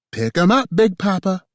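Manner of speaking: high, loud playful yell. A narrator's reading. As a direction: yell it out in triumph. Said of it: "she yelled".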